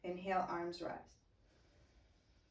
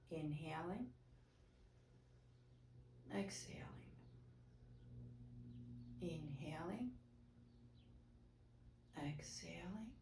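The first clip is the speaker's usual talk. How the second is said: hushed, softer guidance through the breath; soft breath cues; 9 dB quieter than usual. Real, not acted. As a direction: guide the breathing softly, voice dropping away. Yoga teacher counts slow breaths.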